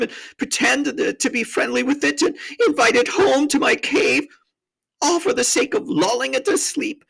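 high, wailing self-accusation; hammed-up distraught high voice; tearful confession. A narrator's reading. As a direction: pour out guilt as a weeping faun. Tumnus confesses in tears.

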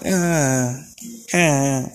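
cooing high baby talk, mocking the parents. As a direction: coo at it like a parent talking to an infant. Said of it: "in a fucking baby voice just like them".

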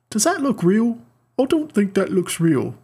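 a poor imitation of a director doubting the CGI; bad Taika accent. Podcaster fumbles an impression of the director, bad Kiwi accent. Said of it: "Why am I so bad at this accent".

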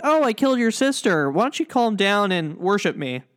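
imitating a sassy villain. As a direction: toss it off with mock-casual sass. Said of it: "he's sitting very sassy".

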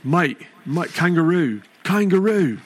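high Australian accent warm-up. Shown as a demonstration. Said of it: "words I gotta say before I get going".

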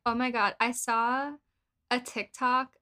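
normal talking voice

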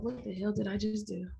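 hushed, stunned aside; inner thought. Retold or imitated. Bride in the car after the wedding, inner thought. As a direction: say it softly, as if only to yourself. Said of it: "And in my mind".

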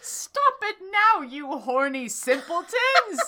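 high, loud mock scolding shout; a hammed-up teacher's yell, high and loud. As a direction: shout them apart like an outraged professor. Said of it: "shouted Professor McGoggle".

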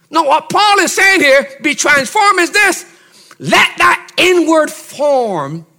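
high-pitched, forceful sermon delivery; impassioned preaching. Real, not acted. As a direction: press the point home to the congregation, pitched high.

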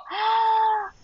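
high, drawn-out mock gasp-cry; exclamación actuada. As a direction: let out a high, held "ah". Acts out the overwhelmed reaction. (grito ahogado, agudo y sostenido, actuado)

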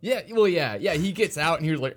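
ordinary delivery

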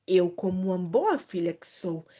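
ordinary delivery

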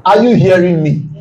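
ordinary talk

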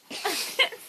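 high, loud burst of laughter; Lachen. Real, not acted. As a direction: burst out laughing mid-explanation. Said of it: "ich muss eben lachen". (lautes, hohes Auflachen)

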